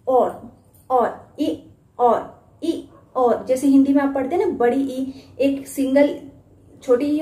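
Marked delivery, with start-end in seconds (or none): 0.9-3.4 और and ई said slowly, each held; phonics sounds ee and or. Shown as a demonstration. Teacher contrasting the two sounds. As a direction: alternate long और and long ई. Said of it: "यहाँ पे एक sound है ओ आ".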